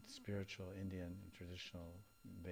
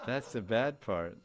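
laughing; 3 semitones higher, 12 dB louder, slower than usual. Real, not acted. Laughing while saying it.

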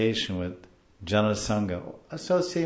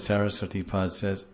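normal talking voice

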